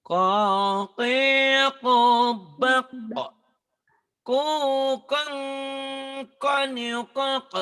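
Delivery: very high, loud and drawn out chant; loud drawn-out recitation for the class; loud version. Shown as a demonstration. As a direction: belt each syllable slowly at full voice. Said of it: "Kalau kencengnya".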